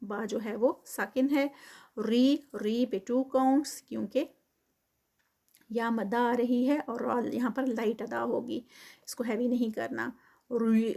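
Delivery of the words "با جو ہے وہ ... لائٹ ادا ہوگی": ordinary talk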